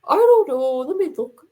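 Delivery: mock child voice, reluctant; Dante. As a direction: imitate a doubtful kid, loud at first. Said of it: "the voice I use for him".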